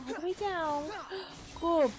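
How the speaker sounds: high loud praising pet voice. Real, not acted. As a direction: praise the dog warmly. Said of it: "revealing my baby talk".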